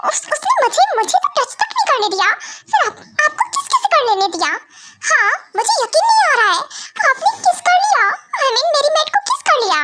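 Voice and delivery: high-pitched, stammering shock; shocked disbelief. Acted. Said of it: "ऐसे react कर रहा है".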